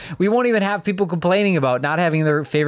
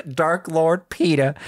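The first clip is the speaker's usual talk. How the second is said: ordinary talk, nothing marked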